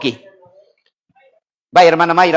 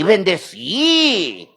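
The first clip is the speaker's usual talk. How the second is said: "bendecí" with a long stretched final vowel; drawn-out emphasis on "bendecí"; 4 dB quieter, much slower than usual. Real, not acted. Preacher repeats God's blessing, stretched "bendecí". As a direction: hold the last í of "bendecí".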